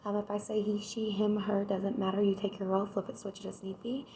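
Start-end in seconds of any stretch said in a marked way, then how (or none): none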